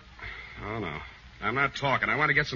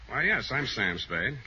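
ordinary talk, nothing marked

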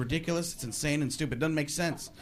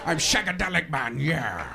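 Connery as Powers; 3 semitones lower than usual. Shown as a demonstration. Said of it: "Quick impression".

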